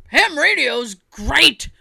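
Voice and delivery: slow, dorky mock enthusiast; nerdy voice. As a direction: drag it out like a geek. Quoting ham radio people.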